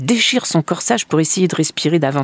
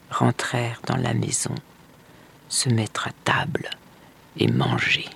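soft, low storytelling close; hushed ending; 5 semitones lower, 6 dB quieter than usual. A narrator's reading. Narrator tells of the brother's return, hushed. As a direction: settle gently to a quiet end.

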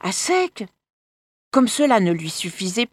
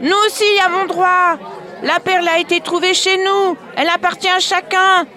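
crowd shouting; 8 semitones higher than usual. Acted. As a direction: shout it like an angry village mob. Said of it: "Les uns criaient".

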